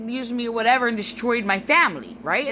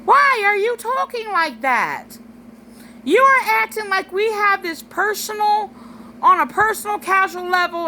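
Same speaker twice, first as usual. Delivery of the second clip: annoyed pushback; 9 semitones higher than usual. Retold or imitated. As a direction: scold him in a raised, pitched-up voice. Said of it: "an annoyed brother. And this is how I described it to him".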